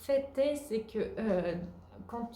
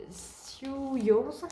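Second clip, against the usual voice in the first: higher, self-mocking English accent on the brand name; 5 semitones higher than usual. Knitter jokes about her English accent, says the shop name in mock English. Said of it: "avec mon super accent anglais".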